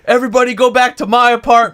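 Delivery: high, loud call to the whole bar; loud party invite. Retold or imitated. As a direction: shout it like the drunk host rounding everyone up. Drunk friend inviting everyone over.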